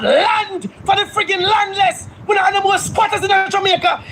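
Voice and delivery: high, shouted call to action; fiery activist rallying the nation. Real, not acted. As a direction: rouse the people into the streets. Rallying cry to stand up.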